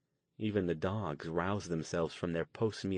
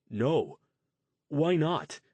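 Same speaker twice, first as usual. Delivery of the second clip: shocked disbelief; 7 semitones higher, 7 dB louder, slower than usual. Acted. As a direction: pitch jumps high on why, louder. Jason shocked, eyes widened.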